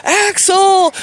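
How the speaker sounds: high-pitched mock yell for a dog; imitated yelling. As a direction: call out high, like a neighbor yelling. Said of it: "my neighbor was out yelling".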